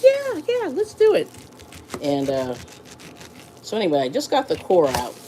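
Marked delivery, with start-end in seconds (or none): 0.0-1.3 much higher, eager imitation of her own yes. Telling the counter guy she wants it Indian-style, eagerly high. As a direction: jump at the dare with gusto. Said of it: "And I was like".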